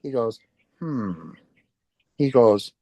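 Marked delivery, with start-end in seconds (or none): 0.8-1.3 low, soft "hmm"; pondering hum. Retold or imitated. Imitating the stranger humming before naming a city, pondering. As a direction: hum quietly, falling in pitch. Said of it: "I remember him humming".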